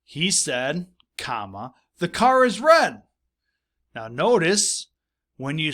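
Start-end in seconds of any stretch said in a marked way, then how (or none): 2.0-3.0 put-on the boy's line, higher and louder; emphatic quote. Teacher voicing the boy's line for the class, emphatic. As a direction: punch the quote out.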